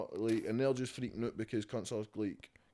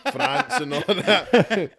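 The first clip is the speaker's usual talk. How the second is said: laughing mockery; 9 semitones higher, 13 dB louder, much slower than usual. Real, not acted. Mocking list of allies.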